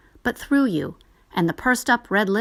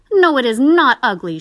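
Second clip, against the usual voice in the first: put-on higher, louder girl's protest; cried; 6 semitones higher, 9 dB louder than usual. A narrator's reading. Sister protests to her brother, louder and higher. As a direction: fiercely defend the doll. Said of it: "she cried".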